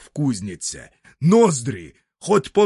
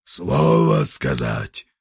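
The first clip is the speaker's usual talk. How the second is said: very low, slow bear roar; 14 semitones lower, 3 dB louder, slower than usual. Acted. The bear roars the words back at grandfather. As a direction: growl it deep like a bear. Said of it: "ревнул медведь".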